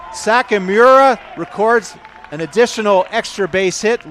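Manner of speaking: shouted high and loud, riding the big hit; excited home-run call. Real, not acted. Commentator calling a big double for radio listeners, excited shout. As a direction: call the ball off the bat at full voice, then stay keyed up.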